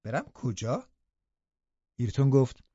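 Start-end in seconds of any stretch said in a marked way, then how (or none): 0.0-0.9 puzzled question with a rising end; taken-aback girl's query; surprised. A narrator's reading. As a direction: ask it in mild astonishment. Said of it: "کاترین با تعجب پرسید".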